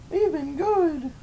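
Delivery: sweet squeaky puppet; high puppet voice. Pretend. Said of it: "do a puppet show".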